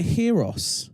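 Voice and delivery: gyros said as "HEE-ros", with an H; overheard odd pronunciation. Retold or imitated. Speaker reports a crazy version of gyros, as "HEE-ros". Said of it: "I heard someone say gyros".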